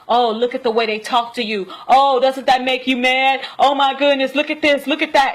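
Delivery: high, mounting mock outrage; goading Leviathan. Leviathan provoking her over how they talk, goading. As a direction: egg her on, pitch and volume climbing.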